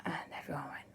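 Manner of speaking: plain reading voice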